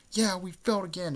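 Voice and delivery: high-pitched mock lament; acted frustrated echo. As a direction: chime in with cartoon dismay. Second character laments failure.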